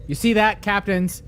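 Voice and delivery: projected host pitch; podcast promo read. Acted. Host inviting captains to become patrons, promo read. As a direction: sell it to the listeners like an ad read.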